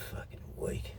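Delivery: contemptuous whisper. Real, not acted. Contemptuous aside after ranting.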